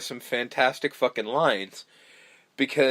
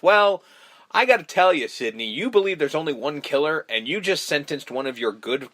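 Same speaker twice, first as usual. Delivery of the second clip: ordinary talk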